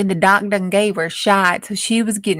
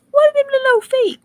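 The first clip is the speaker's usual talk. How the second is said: squeaky baffled voice; faster than usual. Acted. Deputies' baffled question.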